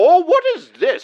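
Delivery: ordinary delivery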